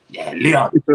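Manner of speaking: put-on light Jamaican accent, dad's voice; imitating a Jamaican dad's accent. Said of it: "in his Jamaican accent".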